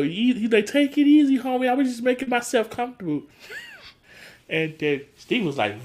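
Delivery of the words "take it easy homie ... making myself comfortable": higher-pitched placating protest; retold defensive excuse. Cedric calming Steve down, defensive excuse. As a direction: plead it lightly, pitched up.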